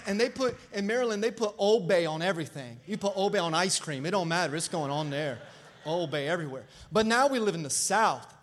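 ordinary talk, nothing marked

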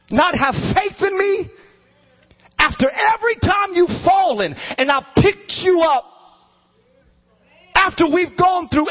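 high, slow, building shouted rebuke; Jesus rebuking. Acted. Jesus rebuking the fearful disciples, rising to a shout. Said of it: "this is what he said".

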